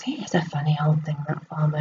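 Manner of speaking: soft, hushed, quick aside; thinking it to himself under his breath; muttered thought. Acted. Neighbour privately judges the farmer, hushed and quick.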